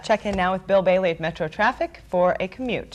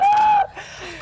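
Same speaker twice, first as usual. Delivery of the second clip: burst of giggles. Real, not acted. Giggling fit after botched joke.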